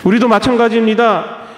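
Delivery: ordinary delivery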